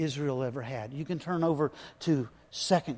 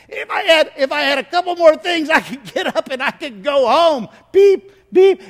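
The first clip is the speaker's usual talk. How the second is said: loud, much higher desperate plea; dying patient begging for more stuff; pleading dying man; 12 semitones higher, 14 dB louder than usual. Retold or imitated. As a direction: beg for more things, voice straining.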